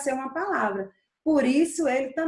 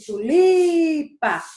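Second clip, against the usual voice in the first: "tulipa" with a long, loud "li"; stretched stressed syllable; 4 semitones higher, 7 dB louder, much slower than usual. Shown as a demonstration. Teacher showing the strongest syllable, "li" of "tulipa" stretched out. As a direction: swell and hold the "li" in "tulipa". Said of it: "passei mais tempo no li".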